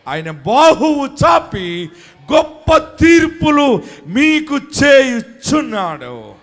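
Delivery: high, loud, slow shouted sermon; shouted preaching. Acted. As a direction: shout the blessing over the congregation. Climactic proclamation. (సభకు గట్టిగా అరిచి చెప్పే ప్రసంగం)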